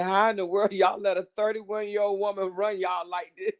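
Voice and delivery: reading it out through laughter; amused retelling of a friend's message; laughing quote. Retold or imitated. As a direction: laugh while quoting her. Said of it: "She said".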